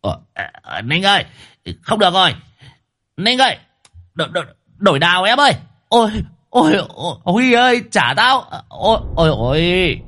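high, panicked yelps, hammed-up; squealing in distress. Mạc Nát squeals to Ninh as the huge girl pins him, high and panicked. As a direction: squeal for help, stammering. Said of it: "kêu lên oai oái".

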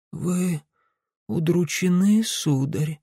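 put-on faint, frail old woman's voice; weak old woman. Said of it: "сказала старушка слабым голосом".

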